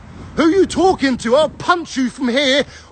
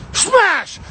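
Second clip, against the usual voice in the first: a sudden shout, much higher; shouted smash; 8 semitones higher than usual. Retold or imitated. Shouting the moment he headbutts the driver. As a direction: bark the impact word out.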